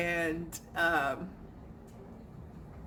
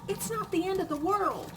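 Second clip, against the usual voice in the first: higher, lightly exasperated reassurance; imitating reassurance; 8 semitones higher than usual. Telling husband it's only a chair, high and reassuring. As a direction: talk him down, pitched high. Her reply quoted to husband.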